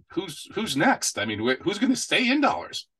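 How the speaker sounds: pitched high, wondering aloud; who could stay, said in disbelief; incredulous. Real, not acted. Host marveling at countries ditching the dollar, high incredulous.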